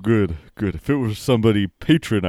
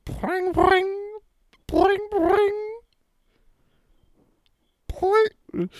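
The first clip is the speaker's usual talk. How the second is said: high boing sound; much slower than usual. Acted. High phone-ring noise.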